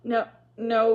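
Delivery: loud, distressed protest; desperate objection; crying out. A narrator's reading. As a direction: cry out to stop him. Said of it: "cried Felicity".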